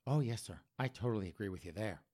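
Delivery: calm dispatcher, hushed; soft low 911 operator. Retold or imitated. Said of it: "Nine one one operator's like".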